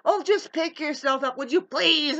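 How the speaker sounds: high, loud, mildly hammed-up nagging; mock scolding. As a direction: imitate someone impatiently telling them off. Voices someone scolding the struggler.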